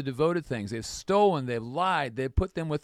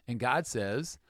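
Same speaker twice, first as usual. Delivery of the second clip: ordinary talk, nothing marked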